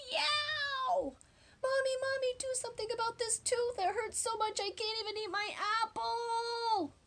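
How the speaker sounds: put-on high, whiny child crying out in pain; childish yell. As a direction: wail like a little boy with a sore tooth. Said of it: "and yelled".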